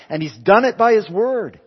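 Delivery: high, loud climactic proclamation; full-force preaching. Real, not acted. Preacher proclaiming victory by his word, full-force preaching.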